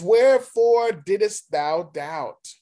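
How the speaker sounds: declaimed challenge. Acted. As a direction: press the question as a proclaimed rebuke. Reading Jesus' question to Peter.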